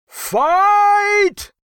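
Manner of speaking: very high, loud, stretched yell; thundering battle cry. A narrator's reading. Gnome mob charging their ruler, thundering battle cry. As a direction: cry it out across the cavern. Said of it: "The cry thundered out".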